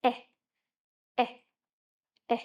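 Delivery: Eh as short e with a small smile, stretched a little; short E sound. Shown as a demonstration. Teacher modelling the egg sound for children, short e. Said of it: "stretch the sound".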